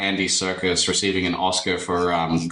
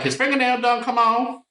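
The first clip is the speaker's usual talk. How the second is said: very high, squeaky fake Southern voice; put-on Southern accent. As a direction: overdo the Southern caller, pitched way up. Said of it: "most put-on Southern accent".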